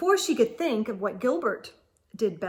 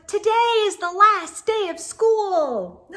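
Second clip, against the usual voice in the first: excited shout; 9 semitones higher, 6 dB louder than usual. A narrator's reading. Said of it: "Gilbert shouted".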